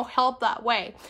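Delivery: ordinary talk, nothing marked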